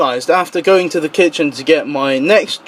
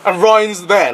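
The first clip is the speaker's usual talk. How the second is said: ordinary talk, nothing marked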